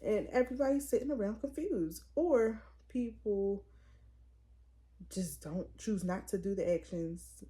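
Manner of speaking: ordinary delivery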